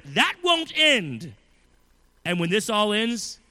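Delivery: loud, driving sermon voice; preaching at full force. Acted. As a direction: preach it hard to the congregation.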